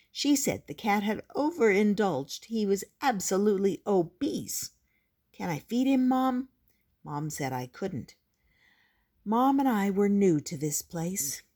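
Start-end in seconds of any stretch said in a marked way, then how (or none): 5.3-6.5 put-on child asking. A narrator's reading. Child pleading with Mom.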